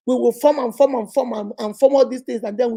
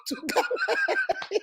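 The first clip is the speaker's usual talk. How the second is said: laughing; 6 dB quieter, much slower than usual. Real, not acted. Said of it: "laughing while speaking".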